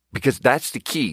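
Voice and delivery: ordinary delivery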